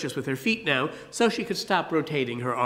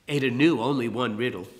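plain reading voice

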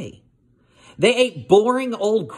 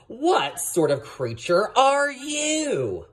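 slow, polite question, a little higher; 8 semitones higher, much slower than usual. Acted. Little hippo politely asking the rhino, slow and polite. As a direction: ask politely and slowly, a bit higher. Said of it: "Portly said politely".

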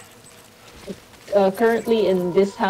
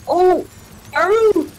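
surprised; 9 semitones higher, 4 dB louder, slower than usual. Real, not acted. Reacting to "and female" requirement.

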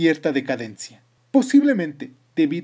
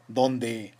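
ordinary delivery

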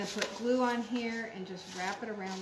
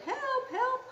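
very high mock scream for help; 14 semitones higher, 4 dB louder than usual. A narrator's reading. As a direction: squeal it out in storybook panic. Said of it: "screamed Mom".

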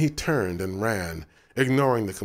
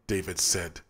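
ordinary delivery

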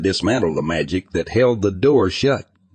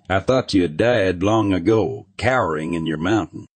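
usual speaking voice

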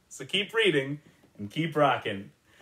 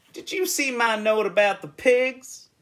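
farmer on the phone; 9 semitones higher, 5 dB louder than usual. A narrator's reading. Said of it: "he asked".

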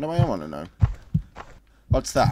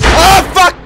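startled scream; 14 dB louder than usual. Real, not acted. Jump scare in horror game.

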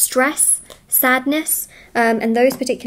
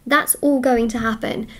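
ordinary delivery

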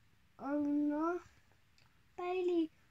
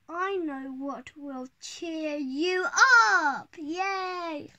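excited; 9 dB louder than usual. Acted. Robot cheering up dog.

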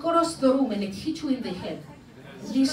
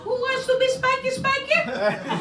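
teasing; 9 semitones higher than usual. Acted. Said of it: "spank your husband".

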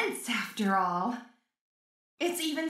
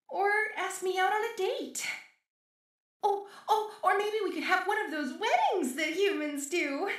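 giddy; 6 semitones higher than usual. Acted. Giddy about dating the creator.

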